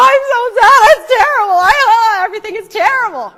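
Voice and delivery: loud, high, rushed wailing lament; mock wailing. Shown as a demonstration. Teacher showing how people really weep, mock wailing. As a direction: wail it out in a rush of sobs. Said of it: "You go".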